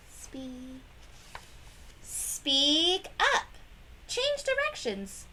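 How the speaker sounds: ordinary delivery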